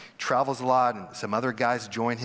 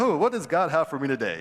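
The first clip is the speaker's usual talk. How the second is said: mock cheerful; 3 semitones higher, 4 dB louder than usual.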